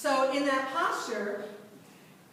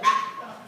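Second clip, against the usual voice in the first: sudden breathy scared yelp; short unvoiced startled cry; 5 dB louder than usual. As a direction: jump back with a gasp like a startled passerby. Startled passerby screams at snowman.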